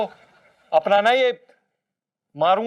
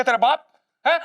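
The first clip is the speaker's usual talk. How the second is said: ordinary talk, nothing marked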